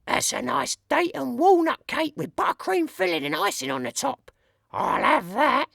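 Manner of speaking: very high, sing-song woman thief; a greedy lady coveting loot; burglar lady voice. A narrator's reading. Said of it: "The voice says".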